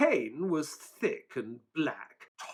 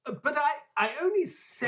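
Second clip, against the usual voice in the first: flustered; 11 semitones higher, faster than usual. Acted. Watson flustered by Holmes's rebuke.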